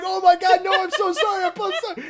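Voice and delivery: high, frantic mock apology; panicked apology. Caught walking in on old man, frantic apology. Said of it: "I'm like".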